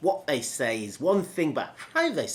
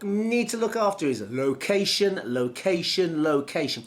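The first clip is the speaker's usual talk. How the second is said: location, location, location, each stressed; repeated for emphasis; 4 semitones higher than usual. Shown as a demonstration. Coach showing repetition of a key word, emphatic. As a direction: drive home location three times. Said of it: "repeat a key word, verb, adverb three times".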